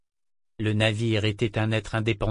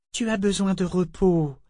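higher-pitched, syrupy concern; honeyed false courtesy; 7 semitones higher than usual. A narrator's reading. Man feigns concern for Althea, honeyed and falsely courteous. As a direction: coax with fake politeness. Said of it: "dit-il doucement, mielleux, faussement courtois".

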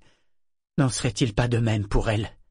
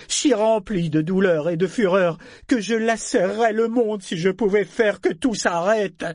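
higher, louder, building fury; swelling roar; 6 semitones higher, 4 dB louder, slower than usual. A narrator's reading. Mad ship rages at its family, voice swelling to a roar. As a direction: swell from bitter agreement into a roar. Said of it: "Il avait enflé la voix jusqu'au rugissement".

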